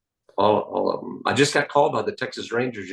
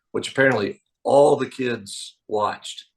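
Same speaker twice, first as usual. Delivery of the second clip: usual speaking voice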